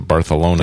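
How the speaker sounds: "Barcelona" with th in place of the c; Barcelona, c turned into th; lisped Spanish pronunciation. Shown as a demonstration. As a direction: say Barcelona as Bar-tha-lona. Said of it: "pronounce it Barcelona".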